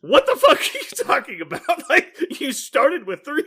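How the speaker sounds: high, loud words through laughter; giggling incredulous retelling; laughing mock outrage. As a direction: laugh while you scold.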